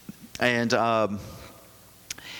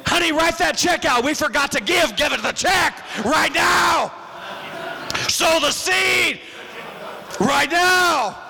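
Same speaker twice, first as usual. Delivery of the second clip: mock churchgoer shouting; 10 semitones higher than usual. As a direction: shout it at your wife like a zealous churchgoer. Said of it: "I'm going".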